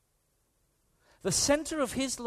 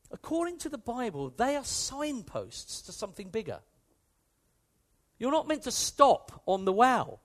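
usual speaking voice

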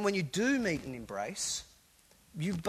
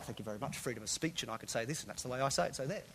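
mock defiant quote; 8 dB quieter, much faster than usual. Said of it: "the anti-P C person who will say what they jolly well like".

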